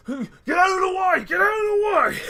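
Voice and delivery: frantic high shout to clear the street; a guard screaming at everyone to move; guard yelling. Acted. Armored guard yelling at bystanders, high frantic shout.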